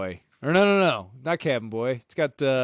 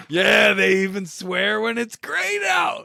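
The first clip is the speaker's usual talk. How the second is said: excited shout; 9 semitones higher, 6 dB louder, slower than usual. Retold or imitated. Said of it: "It's like".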